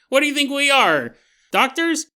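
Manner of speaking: high, sarcastic "what do you think we are"; a sarcastic, mocking retort; mock incredulous. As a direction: scoff at the question. Mocking a silly question.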